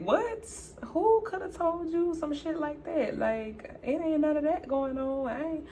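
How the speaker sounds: high-pitched, incredulous protest; flustered denial. Retold or imitated. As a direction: feign shock at the accusation. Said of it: "Y'all, I'm stuttering".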